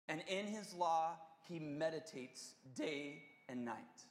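slow, solemn reading of a psalm; scripture reading. Acted. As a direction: read it like holy text, deliberately. Reads Psalm one aloud.

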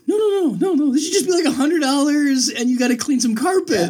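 very high, pleading backpedal; mock panic at things going too far; retold alarm. Retold group panic as stakes escalate.

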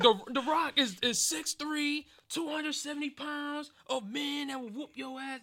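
high, loud wrestler boast; imitated hard-hitting promo. Fan retelling The Rock's feud, imitated hard-going boast. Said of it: "like, going hard".

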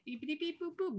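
high, soft beep-boop noises; mock phone beeps; imitated dialing beeps. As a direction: beep out a dial-up in a high little voice.

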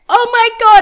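high, loud shocked gasp; sudden shock at the sight. Retold or imitated. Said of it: "she's like".

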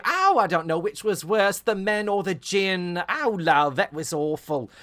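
mock Cockney music-hall singer, high and loud; old Cockney. Podcast host imitating a Cockney dance hall singer. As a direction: ham up an old Cockney showgirl. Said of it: "she's doing the old talking Cockney".

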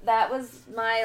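usual speaking voice

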